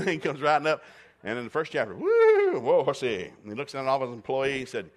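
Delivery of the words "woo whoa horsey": loud, high whoa to stop the horse; calling to a horse. Acted. Boaz pulling up his horse in the field, loud high call. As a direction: holler the horse to a halt, much higher.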